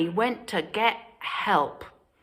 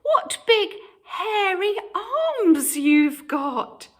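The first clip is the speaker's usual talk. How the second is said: put-on little girl voice; 13 semitones higher, 4 dB louder, slower than usual. A narrator's reading. Little Red speaks.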